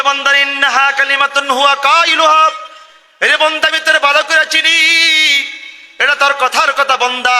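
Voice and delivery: high, loud proclaimed rebuke; loud divine verdict. Acted. God rebuking the lying servant, high and loud. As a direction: declare it to the whole crowd. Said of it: "আল্লাহ বলে".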